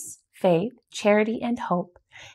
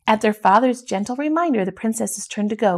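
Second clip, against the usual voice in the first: plain reading voice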